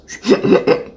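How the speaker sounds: quick run of voiceless hiccup noises; involuntary little stuttered hiccups; hiccup tic. Real, not acted. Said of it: "the hiccup tic".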